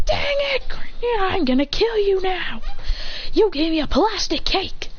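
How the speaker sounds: high angry shout; furious. Acted. Serafina bites the plastic cake, high furious yell. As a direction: yell at him in rage.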